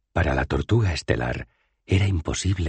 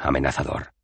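usual speaking voice

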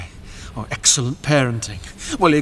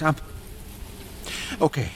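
usual speaking voice

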